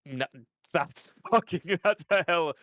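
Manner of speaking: normal talking voice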